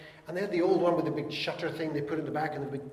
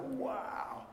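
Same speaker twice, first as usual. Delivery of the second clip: mock amazement; 10 semitones higher, 7 dB quieter than usual. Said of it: "is like".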